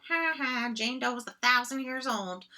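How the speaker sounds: high, sing-song mock of a bad sentence; teasing high mock voice. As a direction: lampoon the sentence in a silly high voice. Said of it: "So both of these are like".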